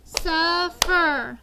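Suffer said loud, SUF-fer split in beats; syllable clapping. Shown as a demonstration.

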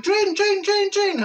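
put-on high excited toddler chant. Toddler chanting at the trains, high and loud. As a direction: squeal it like a wee girl seeing trains. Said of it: "And she's going".

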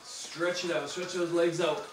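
normal talking voice

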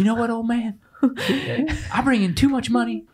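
high-pitched comic comeback; high, softened mock defiance; mock movie retort. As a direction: quote the movie character talking back. Quoting the movie's reply to old man.

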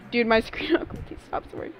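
ordinary delivery

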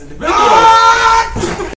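slow, loud, high shout; sudden shout. Acted. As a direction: blow up on the last words. Sudden outburst in calm sentence.

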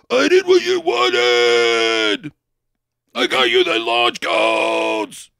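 slow, drawn-out tough-guy impression; weird Sylvester Stallone. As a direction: drag it out like a mumbling action hero. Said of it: "You kinda sound like a weird Sylvester Stallone".

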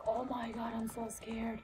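dropping to a scared whisper; imitating her own nervous whisper; mock scared whisper. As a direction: whisper it like you dread the call. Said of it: "and I'm just like".

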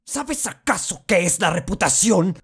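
high-pitched, accusing rhetorical question; stage indignation, pitched well up; indignant challenge. Acted. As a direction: throw the question out high, as a reproach. Confronts sister about lost reputation.

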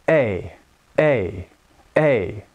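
clear, separate repetitions of A; clearer A. Shown as a demonstration. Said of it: "how much clearer it sounds".

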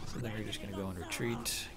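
usual speaking voice

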